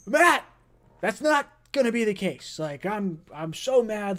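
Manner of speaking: mock outrage. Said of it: "And I was like".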